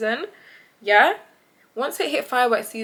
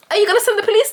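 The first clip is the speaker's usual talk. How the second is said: fake scared; 11 semitones higher, 7 dB louder, much faster than usual. Fake scared call continues.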